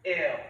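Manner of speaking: loud, recoiling ew; disgusted ew. Real, not acted. Disgusted at being compared.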